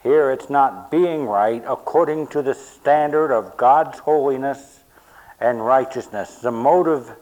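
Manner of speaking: loud, driving sermon delivery; full-force preaching. Acted. Pastor hammering home the Greek meaning to the church. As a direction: press every point home from the pulpit.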